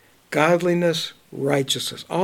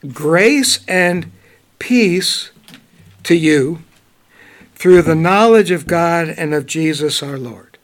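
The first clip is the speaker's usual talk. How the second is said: scripture reading; 4 dB louder than usual. Acted. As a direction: read the verse out with weight. Reads scripture aloud.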